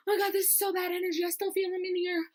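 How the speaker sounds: fast high-pitched panic; frantic high chatter. Retold or imitated. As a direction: rattle it off spooked. Friend voiced frantic.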